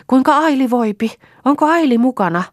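high, loud calling shout. A narrator's reading. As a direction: call out across the water to the boat. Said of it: "juosta loimotti rantaan ja huusi". (kova, korkea huuto rantaan)

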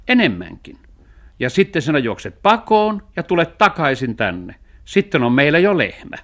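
lightly voiced persuasive cat, loud; scheming cat. Cat proposes selling the dog, scheming. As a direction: talk the dog into the plan, high and loud.

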